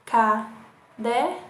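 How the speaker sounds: slow, syllable by syllable; syllables drawn out. Shown as a demonstration. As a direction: split it into beats slowly. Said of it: "na palavra caderno". (escandindo devagar)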